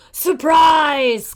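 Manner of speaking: loud gleeful cry. Acted. Wolf bursting in on Granny, loud cry. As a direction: burst in shouting. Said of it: "cried the wolf".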